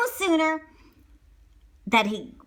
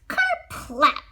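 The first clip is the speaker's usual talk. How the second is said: ordinary delivery, nothing marked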